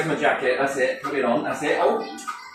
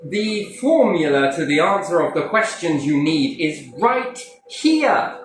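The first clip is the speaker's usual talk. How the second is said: lightly voiced puzzled Einstein; 5 semitones higher, slower than usual. Voicing Einstein's musing.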